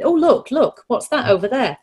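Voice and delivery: bright, high, playful "look over there"; cheery distraction voice. Shown as a demonstration. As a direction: pull a toddler's attention away, cheerfully. Distracting a toddler mid-meltdown.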